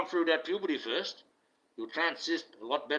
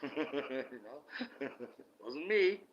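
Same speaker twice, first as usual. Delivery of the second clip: chuckling through the words; laughing; 8 dB quieter, much slower than usual. Real, not acted. Joking to viewers about childish fibs, laughing. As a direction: let the laugh break up the words.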